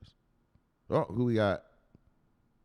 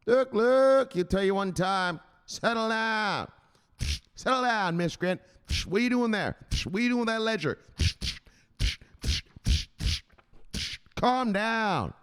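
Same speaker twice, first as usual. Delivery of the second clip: stern enforcer; 9 semitones higher than usual. Acted. Enforcer scolding a jittery crypto investor, high and forceful. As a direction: lay down the law to a nervous investor. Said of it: "Andrew Richard, the enforcer".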